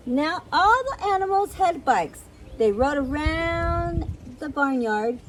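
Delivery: animated picture-book reading; lively storyteller with swooping pitch; story narration. A narrator's reading. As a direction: read it to small children, bouncy and clear. Reads a picture book aloud.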